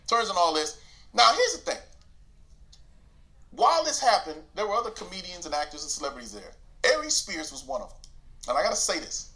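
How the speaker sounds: ordinary delivery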